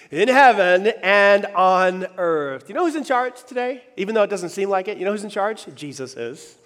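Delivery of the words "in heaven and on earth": much louder, drawn-out proclaiming; slow call-and-response. Shown as a demonstration. Pastor calling the church to repeat, loud and slow.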